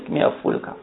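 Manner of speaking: breathy whisper, give me a full cup; whispered plea. Acted. Disciple pleading with the sheikh for tea, whispered. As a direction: beg for the cup in a hush. Acting a thirsty murid asking.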